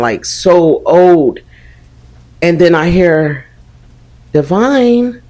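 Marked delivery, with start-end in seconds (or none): 4.3-5.2 high, drawn-out spooky call of a name; eerie witch call. Retold or imitated. Spirit calling her name from by my head, eerie and high. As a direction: call out like a ghostly old witch. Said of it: "the voices".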